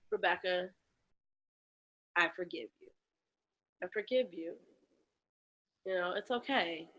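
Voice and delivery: whisper-soft self-forgiveness; tender self-forgiveness. Retold or imitated. Forgiving herself alone in the apartment, tender. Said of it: "And I just said".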